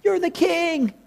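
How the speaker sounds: pleading soldiers. Acted. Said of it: "And the soldiers are like".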